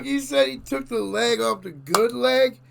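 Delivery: high, loud disbelief; incredulous outburst. Real, not acted. Disbelief at what clip said.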